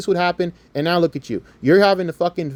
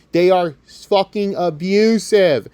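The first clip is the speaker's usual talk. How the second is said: ordinary talk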